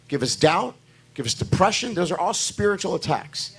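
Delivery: ordinary delivery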